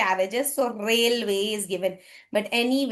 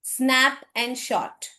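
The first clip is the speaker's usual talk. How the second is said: "Snap and short" spelled out slowly, word by word; slow, deliberate; much slower than usual. Shown as a demonstration. Teacher repeating the rule name slowly for the class. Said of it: "अब यहाँ पे क्या है?".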